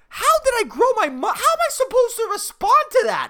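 high, indignant comic squeal; exasperated disbelief. Acted. Barista repeats customer's mustache question, in exasperated disbelief.

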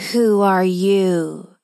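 slow menacing witch voice; witch's loud angry cry. A narrator's reading. Witch challenging the girl at her door, slow and menacing. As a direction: drag out the question like a threatening witch. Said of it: "the witch cried in a loud, angry-sounding voice".

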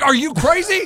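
loud disbelieving yell; incredulous shout. Retold or imitated. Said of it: "I'm like".

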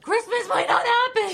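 loud, high panicked cry; whisper yelling. Acted. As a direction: blurt it out in alarm. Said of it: "Keep your voice down".

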